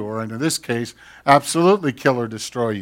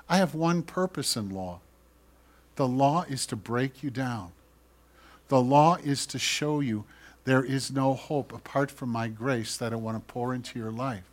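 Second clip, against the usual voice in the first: God whispering; 2 semitones lower, 7 dB quieter than usual. Acted. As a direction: quietly lay out the purpose of the law. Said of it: "God whispers and goes".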